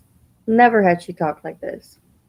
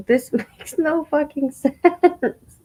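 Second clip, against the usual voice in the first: laughing through the words in disbelief; can't get it out for laughing, slowly; incredulous laugh breaking the line; 9 semitones higher, slower than usual. Real, not acted. Laughs at absurd comment.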